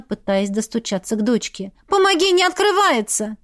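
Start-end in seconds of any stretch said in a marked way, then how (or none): 1.9-3.4 urgent plea. Acted. Mother at the locked door calls for help. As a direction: shout it over your shoulder.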